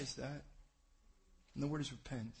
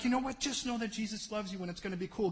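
breezy dismissive; 7 semitones higher than usual. Retold or imitated. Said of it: "some of them are like".

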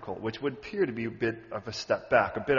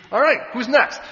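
high, loud call for a challenger; loud challenge; 9 semitones higher, 12 dB louder than usual. Acted. God calls up Pharaoh's next god, loud challenge. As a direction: call it out ready for another fight.

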